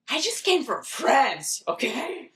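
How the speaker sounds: high, slow, emphatic protest; mock indignation. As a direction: defend yourself with comic exasperation. Retold protest at being late.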